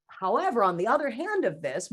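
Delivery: ordinary delivery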